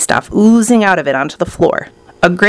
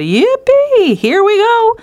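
put-on high, whooping old grandpa cheer; excited shout; 14 semitones higher, slower than usual. A narrator's reading. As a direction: whoop with glee, pitched way up. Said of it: "Grandpa Joe shouted".

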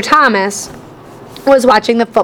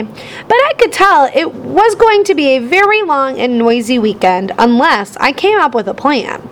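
usual speaking voice

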